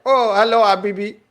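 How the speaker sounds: high, loud mock Pakistani greeting; mock Pakistani accent. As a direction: tease with an exaggerated Pakistani accent. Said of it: "it's 'cause you're racist. You saw Harkarat and you're like".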